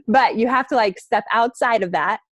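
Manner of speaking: usual speaking voice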